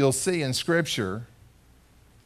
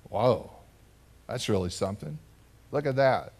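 low, softer voicing of awestruck disciples; hushed awe; 3 semitones lower, 6 dB quieter than usual. Disciples after Jesus calmed the waves, hushed awe. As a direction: breathe it out in wonder.

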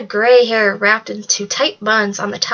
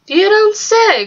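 sly teasing; 10 semitones higher, 3 dB louder, slower than usual. A narrator's reading. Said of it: "with a sly smile".

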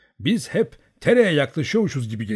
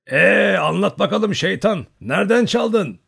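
high, loud jovial shout; bağırarak; 8 semitones higher, 6 dB louder, slower than usual. A narrator's reading. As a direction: bellow it like a tipsy old Cossack. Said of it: "diye bağırdı". (yüksek sesle neşeli bağırış)

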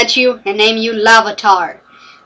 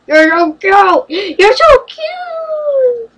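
coo, climbing into a very high, drawn-out squeal; slow gush that rises sky-high on "cute"; gushing cutesy squeal; 11 semitones higher, much slower than usual. Pretend. Gushing over a cute Pokémon.

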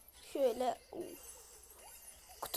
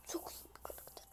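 whisper; 21 dB quieter than usual. Real, not acted. Aside about Rosa.

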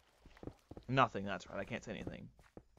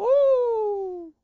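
much higher, louder yelp of laughter; shrieking relieved laugh; 11 dB louder than usual. Real, not acted. Streamer laughing after nearly drowning. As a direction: squeal with relief.

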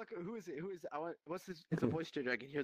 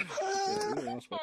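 laughing; 7 dB louder than usual. Real, not acted. Laughing insult at friend.

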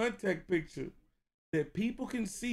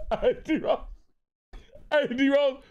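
loud laughing yell; laughing shout; 9 semitones higher, 7 dB louder than usual. Real, not acted. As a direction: yell it while cracking up.

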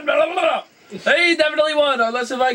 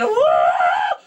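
mock whoa. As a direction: boom out a silly whoa. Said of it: "And then I'm gonna jump down again".

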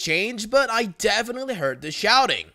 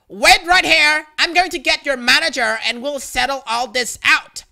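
loud, higher demanding yell at a stranger; angry shout; 7 semitones higher, 6 dB louder than usual. Retold or imitated. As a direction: bark orders like an entitled mom. Angry mom confronting the narrator.

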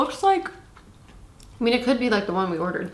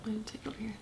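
whispering; 2 semitones higher, 14 dB quieter, much faster than usual. Real, not acted. Aside while eating.

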